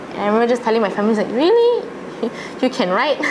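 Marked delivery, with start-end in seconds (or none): 1.4-3.2 high, amazed disbelief. Retold or imitated. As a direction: squeak it out in laughing surprise. Family's amazed reaction, retold.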